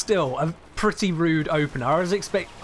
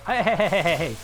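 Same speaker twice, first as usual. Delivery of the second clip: quick, raised string of objections; mock protest; 2 semitones higher than usual. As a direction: cut in with playful protest. Mock objection to chat's jibe.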